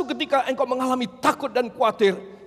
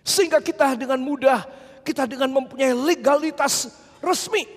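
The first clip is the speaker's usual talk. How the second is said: usual speaking voice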